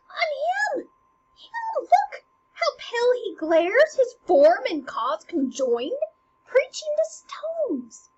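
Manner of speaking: very high, loud acted outcry; frantic Hamlet. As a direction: desperately make someone look at the ghost. Hamlet frantically points out the ghost.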